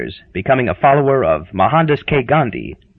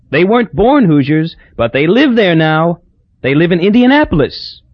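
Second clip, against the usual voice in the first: Hazel's voice; 5 semitones higher than usual. Acted. Hazel's eager chatter.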